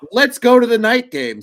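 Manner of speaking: high, loud, pushy imitation of a friend; loud mock urging. Imitating a friend pushing to stay for the night game, loud and high. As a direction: belt it out like a buddy insisting. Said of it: "Joey was also there and was like".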